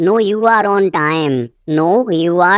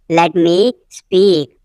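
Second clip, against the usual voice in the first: "let me", a pause, then "speak"; slow it down, isolating "speak"; slow repeat; slower than usual. Shown as a demonstration. Teacher repeating for learners, slow and split.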